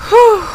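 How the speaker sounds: high, loud exhaled whew; relieved whew. Real, not acted. Player recovering from a near heart attack, relieved whew. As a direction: blow out the shock in one whew. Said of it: "trying to give me a heart attack".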